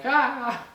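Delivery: sudden loud high "Ah!"; scare yell. Pretend. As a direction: yelp to startle the listener. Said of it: "suddenly I'm like".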